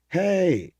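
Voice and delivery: high mock shout of hey; loud high greeting to a far island; calling across the sea. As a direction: yell it across the water. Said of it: "And he says".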